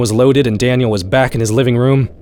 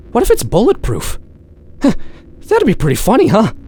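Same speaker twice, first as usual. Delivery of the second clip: much higher, giddy panicky humor; nervous wry joke; 11 semitones higher than usual. Acted. Daniel aiming at the window, high nervous giddy musing. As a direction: laugh off the terror, voice pitched up. Wondering if creature is bulletproof.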